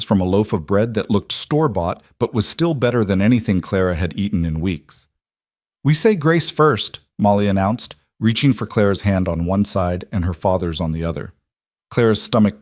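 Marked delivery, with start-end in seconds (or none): 5.8-7.0 put-on bright child's voice, slower; a young girl announcing; little girl voice. A narrator's reading.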